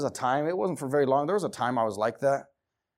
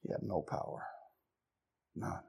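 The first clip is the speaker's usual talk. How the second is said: unvoiced, much softer line; whispered; 9 semitones lower, 14 dB quieter than usual. Preacher gives the hushed verdict on Lot, whispered. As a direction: drop to a grim whisper.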